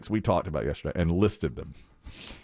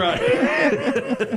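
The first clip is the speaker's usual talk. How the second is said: high laughing agreement; 10 semitones higher, 3 dB louder than usual. Real, not acted. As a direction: laugh through the words. Laugh heard, high pitch.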